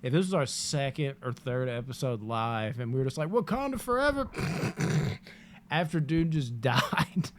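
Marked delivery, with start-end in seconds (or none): 3.3-4.3 high-pitched Wakanda salute call; high mock salute. Imagined salute on stream.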